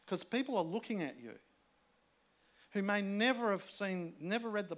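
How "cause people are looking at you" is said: usual speaking voice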